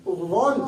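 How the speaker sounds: "غون" full and heavy, teeth open; heavy tajweed articulation of "غون"; full heavy letter. Shown as a demonstration. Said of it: "دانتوں کو کھول کے آواز پور پڑھیں".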